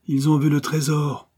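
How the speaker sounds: lightly acted drunkard's accusation; brandy-worn voice. A narrator's reading. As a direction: voice a drink-worn bandit. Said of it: "une voix évidemment rouée par le brandy".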